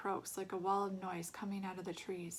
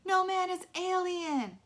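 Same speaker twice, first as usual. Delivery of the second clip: mocking jeer; 11 semitones higher, 8 dB louder, slower than usual. A narrator's reading. Kids mocking.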